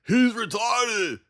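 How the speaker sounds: slow, high mocking shout; mocking dumb voice. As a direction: ham up a dim-witted heckler. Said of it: "and then you're like".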